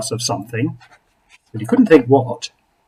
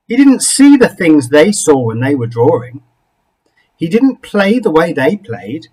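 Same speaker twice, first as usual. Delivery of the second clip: ordinary delivery, nothing marked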